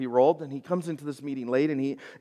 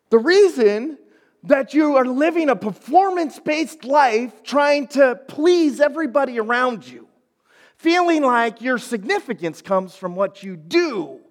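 higher, louder, emphatic sermon delivery; forceful preaching; 9 semitones higher, 6 dB louder than usual. Real, not acted. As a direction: preach it with conviction, stretching key phrases.

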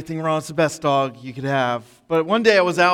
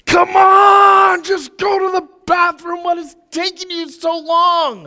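much higher, loud frustrated yell at the dog; lost my temper; 12 semitones higher, 6 dB louder, slower than usual. Retold or imitated. Soaked owner losing it at his dog, high angry yell. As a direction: snap at the dog for the first time. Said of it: "the first time I had lost it with this dog".

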